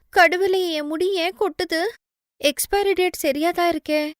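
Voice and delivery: put-on girl panicking over her falling hair; alarmed young woman. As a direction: cry out in a dismayed pitch.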